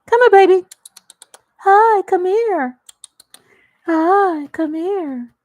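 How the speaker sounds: high, loud pet call; calling the cat. Real, not acted. Calling her cat over, high and loud. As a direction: sing out to the cat to come.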